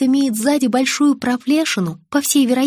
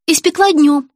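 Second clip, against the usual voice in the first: high, loud, startled reply; alarmed self-justification; frightened; 4 semitones higher, 5 dB louder than usual. A narrator's reading. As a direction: blurt it out anxiously. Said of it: "испуганно заговорила тётушка".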